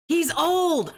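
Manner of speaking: high, drawn-out jeering cry; mocking shout. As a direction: crow it like a taunting catchphrase.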